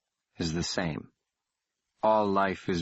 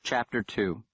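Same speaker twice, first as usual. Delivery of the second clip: usual speaking voice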